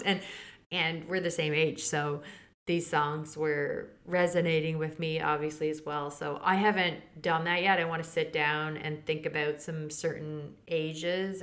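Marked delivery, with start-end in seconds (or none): none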